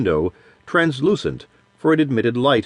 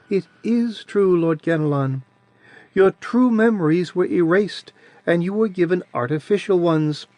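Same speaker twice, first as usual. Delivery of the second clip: put-on sweet childish voice; 6 semitones higher than usual. A narrator's reading. Adiran's voice held.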